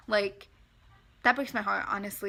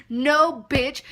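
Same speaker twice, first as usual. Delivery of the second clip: loud, higher angry no; angry refusal; 4 semitones higher, 8 dB louder than usual. Real, not acted. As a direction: shut her down hard. Refusing the scammer.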